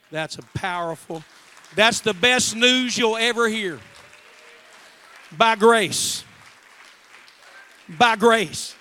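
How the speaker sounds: full-force preaching. Acted. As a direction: hammer each "By grace" home to the crowd.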